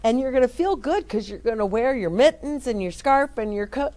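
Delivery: ordinary delivery, nothing marked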